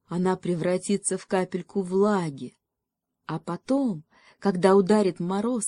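plain reading voice

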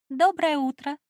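high chirpy princess greeting; cartoon princess. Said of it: "прощебетала Бриэль".

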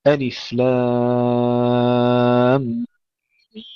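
لام and ميم chanted with very long held vowels; elongated madd. Shown as a demonstration. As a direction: stretch لام and ميم six counts each, humming the nasal between. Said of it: "طول المدود". (إطالة المد في «لام» و«ميم» ست حركات مع الغنة)

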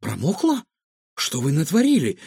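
mild alarmed disbelief; exclaiming. A narrator's reading. Girl learns her companion got soaked, mildly exclaiming. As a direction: question it with a touch of dismay. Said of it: "воскликнула Илона".

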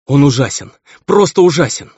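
high, loud horror, building; horrified. A narrator's reading. Feverish son grips mother's hand, horrified. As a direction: climb in pitch on the repeat, appalled.

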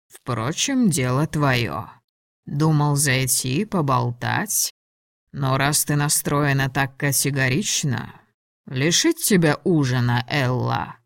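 low, unhurried male dragon voice; taunting dragon. Acted. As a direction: drop low and taunt her coolly. Voices Ladon, taunting her.

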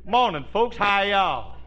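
lightly hammed Southern accent; Southern greeting. [name] greets his family in a Southern accent. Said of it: "that Southern accent as heavy as we can".

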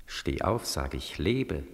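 low, hushed voice from inside; low inner voice. Acted. Inner voice urging Beckmann to wake, low and hushed. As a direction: murmur it low like a voice in his head.

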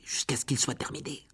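aparté chuchoté. Acted. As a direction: glisser la fin en chuchotant. Ron ajoute à voix basse. (chuchotement complice)

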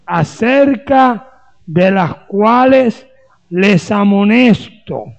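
slow, weighty reading of the verses, each word set apart; measured preacher's reading, slow and deliberate; solemn scripture reading. Acted. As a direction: read it out like Scripture from the pulpit. Reads scripture slowly from pulpit.

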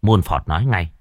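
ordinary delivery

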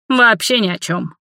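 mildly snide, mocking verdict; understated spiteful jab. A narrator's reading. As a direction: toss it out with a touch of malice. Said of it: "ехидно выдал он".

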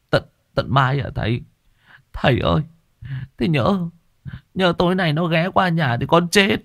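high anxious voice; frightened plea. Acted. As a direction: fear the ghost comes tonight. Patient pleads in fear.

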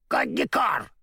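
high mocking crow cry; hammed-up crow caw. A narrator's reading. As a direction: caw it out with a sneer. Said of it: "насмешливо прокричала ворона".

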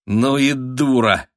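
mock yourself with cold amusement; drawn-out, derisive self-reproach; cold self-mockery. A narrator's reading. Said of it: "Холодный смех разбирал её".

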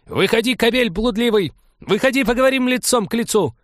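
high, loud screaming challenge; furious yelling. Acted. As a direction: yell through the door, enraged. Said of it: "Разъярённая Эльвира".

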